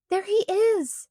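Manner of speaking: a high, excited shout on spotting the lost dog. A narrator's reading. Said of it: "shouted Agatha".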